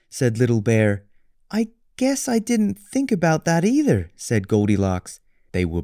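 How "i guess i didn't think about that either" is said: put-on high girlish voice; sheepish girl. Goldilocks confesses to Little Bear, high. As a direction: admit it high and small.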